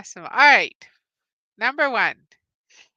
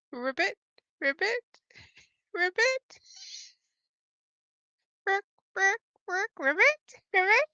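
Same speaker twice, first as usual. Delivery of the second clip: playful froggy ribbits climbing higher; frog croaks; 9 semitones higher than usual. Pretend. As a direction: croak like a little frog to give a hint. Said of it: "I'm giving you a hint".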